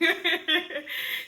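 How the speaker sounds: short high giggle; amused laugh. Real, not acted. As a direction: giggle at your own performance. Laughs after her example sentence.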